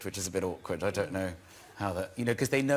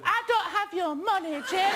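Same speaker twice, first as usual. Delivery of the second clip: imitating a little boy, pitched much higher; squeaky kid playing the accused gangster; child's movie voice. Young son answering in home movie.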